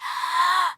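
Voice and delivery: long, steadying breath; deep breath. Acted. Worked-up caller settling their nerves, deep breath. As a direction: breathe deeply to calm yourself. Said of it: "let me just take a deep breath".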